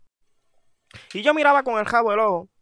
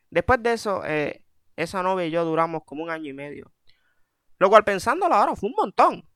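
ordinary talk

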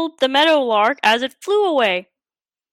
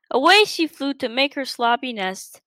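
ordinary delivery

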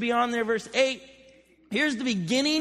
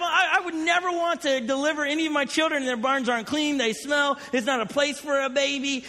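normal talking voice

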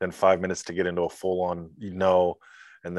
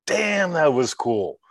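higher, drawn-out "damn" of amazement; awed admiration; 6 semitones higher, much slower than usual. Acted. Film viewer after Bond's parachute escape, amazed. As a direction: marvel slowly at a stunt.

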